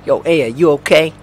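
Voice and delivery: attempted Brooklyn accent, loud. Cop checking on Aya, in an attempted Brooklyn accent. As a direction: try on a Brooklyn tough-guy accent. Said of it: "Could try going with Brooklyn".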